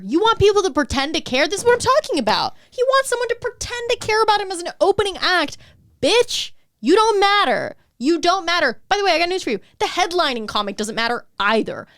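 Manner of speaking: high-pitched, incredulous scolding; exasperated rant. Real, not acted. Comic berating a self-pitying opening act, exasperated. Heated rant at post's author.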